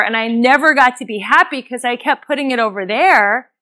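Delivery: usual speaking voice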